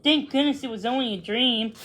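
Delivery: ordinary delivery, nothing marked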